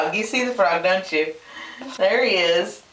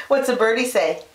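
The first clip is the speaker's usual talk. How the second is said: normal talking voice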